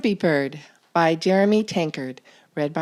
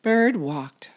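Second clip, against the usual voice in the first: ordinary delivery, nothing marked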